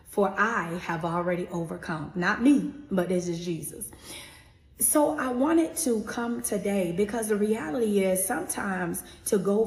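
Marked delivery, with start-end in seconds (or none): 2.9-3.9 whispering. Real, not acted. Reverent aside about Jesus.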